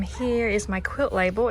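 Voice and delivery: ordinary delivery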